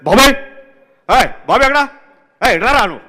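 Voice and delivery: loud, high barking calls for Babai; impression of the shouting director. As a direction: call out for the assistant, sharp and loud. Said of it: "టక్ టక్ వచ్చేస్తారు".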